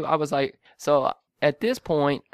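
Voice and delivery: ordinary delivery, nothing marked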